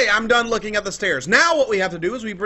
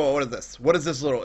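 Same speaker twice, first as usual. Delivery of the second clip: usual speaking voice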